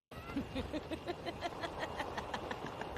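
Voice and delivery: long unvoiced giggle; breathy laugh. Real, not acted. To viewers, before the original clip, breathy laugh. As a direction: laugh under her breath in anticipation.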